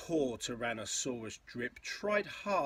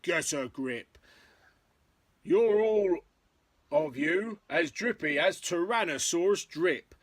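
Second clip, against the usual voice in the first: emphatic storybook telling-off; stern dad; 5 semitones higher, 8 dB louder than usual. A narrator's reading. Dad tells off his trembling family, mock stern. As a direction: scold them in a mock-stern voice.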